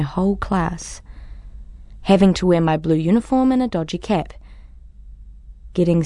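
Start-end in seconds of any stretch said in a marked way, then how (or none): none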